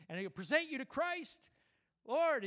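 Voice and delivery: high, loud ceremonial announcement; grand presentation. Acted. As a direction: proclaim it up to heaven, pitched high. Acts out presenting church to God.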